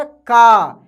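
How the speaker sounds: का with a long, falling aa; long aa sound. Shown as a demonstration. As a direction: draw out का loudly on an open aa. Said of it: "आ का उच्चारण होने लगेगा".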